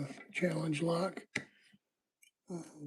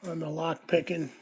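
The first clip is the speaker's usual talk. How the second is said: usual speaking voice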